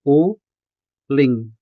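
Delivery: u-ling split, u set apart from ling; u-ling drawn out in syllables; syllabicated uling. Shown as a demonstration. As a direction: say u, pause, ling. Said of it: "Uling can be syllabicated as".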